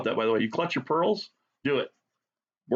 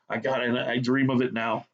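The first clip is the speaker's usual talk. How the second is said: usual speaking voice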